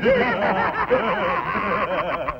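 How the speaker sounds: high villainous chuckle; scheming laugh. Acted. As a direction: laugh like the plan is already won.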